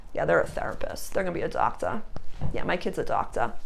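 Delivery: low, soft mock parental brag; imitated boasting parents. Parents bragging to friends about their kid, in mock offhand pride.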